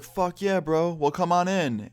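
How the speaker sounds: high, hyped-up party host; imitated enthusiastic bro. Host waving guests into the tent, enthusiastic.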